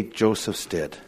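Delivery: barely voiced confession-lie; hushed lie. Brothers telling their father the news, hushed and guilty. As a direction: say it low, unable to meet his eyes.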